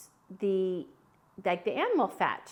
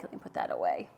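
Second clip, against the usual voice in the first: whispered aside; 5 dB quieter, faster than usual. Real, not acted. Aside while tidying up.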